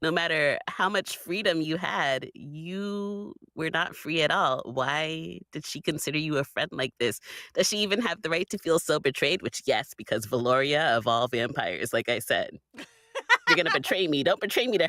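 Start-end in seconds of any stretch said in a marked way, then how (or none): none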